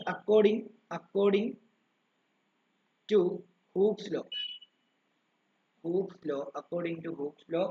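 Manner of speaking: slow, repeated phrases for note-taking; slow dictation. Shown as a demonstration. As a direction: dictate the formula piece by piece. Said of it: "dictating the formula". (സൂത്രവാക്യം പതുക്കെ എഴുതാൻ പറഞ്ഞുകൊടുക്കുന്നു)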